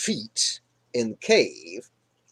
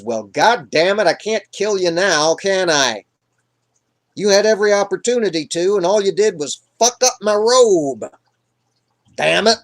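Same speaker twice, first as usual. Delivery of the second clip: louder, higher frustrated grumbling; exasperated rant; 5 semitones higher, 5 dB louder than usual. Acted. Saul to David about his ruined robe, loud exasperation. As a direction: vent like a thwarted king.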